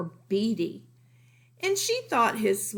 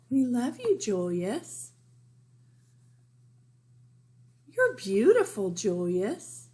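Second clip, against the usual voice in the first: doting parents; 3 semitones higher than usual. Retold or imitated. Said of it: "So Mom and Dad are saying".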